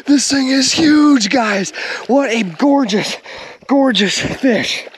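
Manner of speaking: high, drawn-out excited admiration; elated. Real, not acted. Angler admiring a gorgeous catch, elated.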